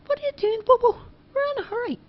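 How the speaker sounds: put-on very high boyish voice; Charlie's voice, urgent. A narrator's reading. Charlie hissing at Bobo to hurry, high boyish voice. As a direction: pitch way up, in a rush. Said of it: "Charlie said".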